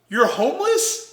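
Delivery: high-pitched incredulous question; puzzled disbelief. Retold or imitated. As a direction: imitate someone mishearing, baffled. Manager mishears hummus as homeless.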